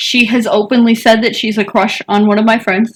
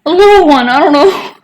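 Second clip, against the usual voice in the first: put-on higher, louder, unsure kid answer; a hint of an indecisive kid, pitched up; lightly voiced kid; 7 semitones higher, 6 dB louder than usual. Child answering what cat he wants.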